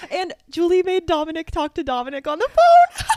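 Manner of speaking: high laughing voice; laughing through the words. Real, not acted. Laughing while telling.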